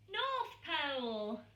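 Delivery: plain reading voice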